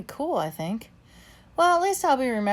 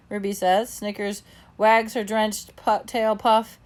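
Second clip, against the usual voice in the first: ordinary delivery, nothing marked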